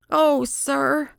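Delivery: high, quivery-hearted woman's exclamation; emotional cry. A narrator's reading. Woman imploring detective about lost bird, emotional cry. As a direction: cry out high with urgent feeling. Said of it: "she cried".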